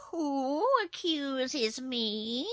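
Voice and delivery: high, very slow cat voice; defiant kitten. A narrator's reading. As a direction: draw it out as a haughty cat. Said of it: "asked the kitten defiantly".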